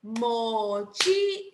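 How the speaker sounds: mochila very slowly, syllable by syllable; sílabas separadas. Shown as a demonstration. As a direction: stretch out mo, chi, la with gaps. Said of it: "¿cuál será la sílaba tónica de mochila?". (mochila muy lento, separado en sílabas)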